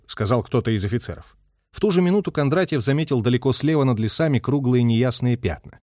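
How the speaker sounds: plain reading voice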